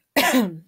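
quick clearing of throat. Real, not acted.

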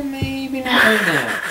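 put-on high, drawn-out baby whine; slow whiny baby voice. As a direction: whine it slowly like a toddler. Voicing baby's refusal.

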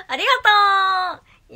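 high, loud, slow gushing thank you; excited thanks. Real, not acted. Thanking a viewer with high excited gush.

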